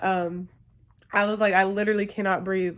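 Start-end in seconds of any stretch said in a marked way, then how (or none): none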